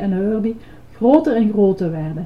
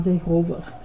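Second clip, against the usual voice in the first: ordinary delivery, nothing marked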